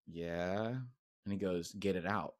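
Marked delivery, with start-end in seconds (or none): 0.1-0.7 low, hushed, sheepish yes; reluctant admission. Retold or imitated. Reluctant secret admission in public. 1.7-2.3 low, soft, secretive order; hushed urging. Retold or imitated. Secretive friend urging in public.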